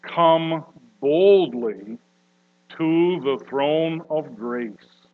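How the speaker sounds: "come boldly" drawn out slowly; slow reading. Acted. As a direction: savour "come boldly" word by word. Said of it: "So let us therefore".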